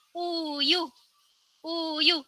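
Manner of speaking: uyu drawn out slowly; slow blended reading. Shown as a demonstration. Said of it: "Dibaca cepet".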